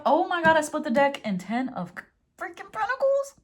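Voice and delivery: high, excited, climbing to a squeak; amazed. Real, not acted. Tarot reader splitting the deck on Pentacles, amazed. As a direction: break off in astonishment, voice shooting up.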